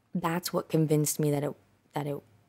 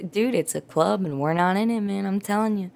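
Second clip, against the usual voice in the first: normal talking voice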